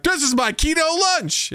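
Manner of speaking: high, smug voice of a fake dieter; mock proud. Mocking a fake keto dieter, proud and smug. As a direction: sell it proudly as health food. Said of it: "and be like".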